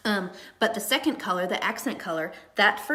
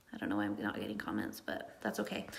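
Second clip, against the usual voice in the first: much lower and softer, to herself; quiet aside; 12 semitones lower, 9 dB quieter than usual. Real, not acted. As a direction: let it slip out under your breath. Aside about missing comments again.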